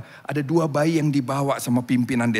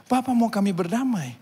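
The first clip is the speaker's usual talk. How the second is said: higher, mildly plaintive query; sad question; 9 semitones higher than usual. Retold or imitated. Saddened warriors ask missionary about peace, mild plaintive question. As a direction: ask reluctantly, a touch of sorrow. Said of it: "mereka mukanya sedih".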